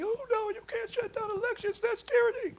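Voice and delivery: high mock-alarmed voice; mock objection. Speaker mocks his own objection, high and alarmed. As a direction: play the shocked liberal. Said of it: "which I would say".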